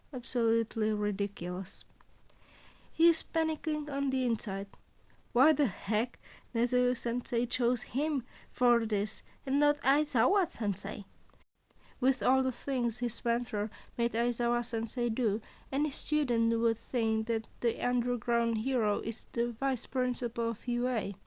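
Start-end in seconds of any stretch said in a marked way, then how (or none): none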